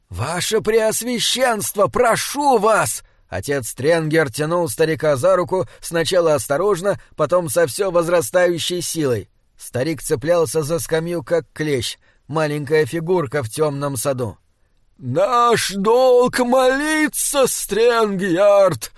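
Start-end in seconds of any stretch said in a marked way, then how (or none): 0.1-3.0 high, drawn-out entreaty; urgent pleading. A narrator's reading. Archivist begs the bishop on the bench, high urgent pleading. 15.0-18.9 put-on high, slow, insistent elderly cleric; stubborn old abbot. A narrator's reading. Old bishop refuses the archivist's plea, stubborn high wail. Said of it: "упрямо ответил аббат Анодис".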